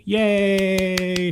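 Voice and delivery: sustained celebratory yay; drawn-out cheer. Real, not acted. Cheering father's remission news.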